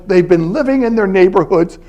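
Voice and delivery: normal talking voice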